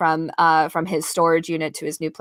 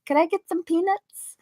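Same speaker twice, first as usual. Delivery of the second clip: mom voice; 10 semitones higher, 4 dB quieter than usual. Retold or imitated. His mom's voice, retold.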